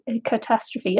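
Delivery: usual speaking voice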